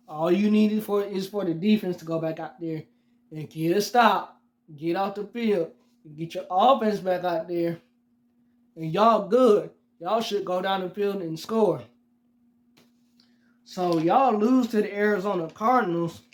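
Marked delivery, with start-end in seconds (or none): none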